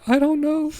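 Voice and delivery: squeaky high cracking voice, near tears. Retold or imitated. Young copywriter to creative director, voice cracking high. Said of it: "my voice is cracking".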